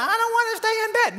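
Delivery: lightly voiced pouting child; whiny child. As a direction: play the kid who won't sleep. Child protesting bedtime.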